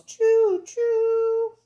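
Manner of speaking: high, loud drawn-out choo choo; playful train whistle imitation, high and long. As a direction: toot like a steam whistle for a toddler. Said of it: "here's the whistle".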